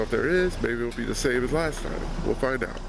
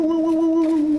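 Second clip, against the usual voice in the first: high, louder falsetto woos; echo hoots; 6 dB louder than usual. Shown as a demonstration. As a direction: hoot high for the tunnel echo. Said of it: "You get, like, a woo".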